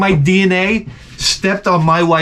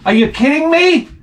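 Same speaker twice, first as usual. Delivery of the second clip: high, indignant mock outrage from the womb; shocked baby voice; baby's outrage; 6 semitones higher than usual. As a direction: play the unborn kid fed up and squeaking. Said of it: "He's like".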